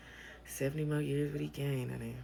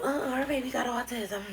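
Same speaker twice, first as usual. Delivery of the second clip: gossiping voice; 9 semitones higher than usual. Retold or imitated. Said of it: "Talk about".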